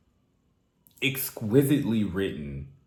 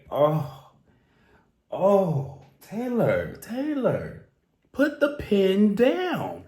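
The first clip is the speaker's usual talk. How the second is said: overwhelmed plea; 9 semitones higher, 3 dB louder, much slower than usual. Real, not acted. Fan overwhelmed by the lyric pleading with [name], high and slow. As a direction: beg her to stop writing so well.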